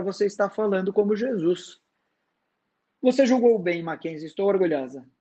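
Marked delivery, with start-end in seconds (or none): none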